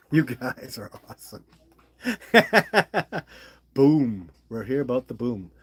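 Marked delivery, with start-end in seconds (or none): 2.0-3.4 high, loud burst of laughter; hearty laugh. Real, not acted. Streamer to his viewers, amused, hearty high laugh. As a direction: laugh out loud in a rapid run.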